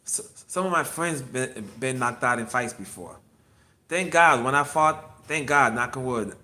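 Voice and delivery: ordinary talk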